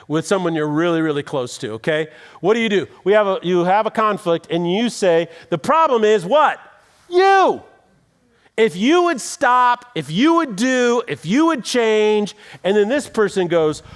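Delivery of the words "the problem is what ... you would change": accusing, punching each you higher and louder; mock marital argument, pointing at the other; blaming spouse. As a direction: jab the finger at your partner with every you. Acted blaming quarrel between spouses.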